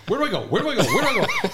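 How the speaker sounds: high, loud frantic repetition; mock panic. Panicked friend looking both ways, frantic and loud.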